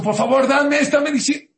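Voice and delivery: loud, high-pitched plea, acted out; imitated desperate yell; desperate shouting. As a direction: cry out as a frantic mother begging. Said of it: "No saben cómo está gritando".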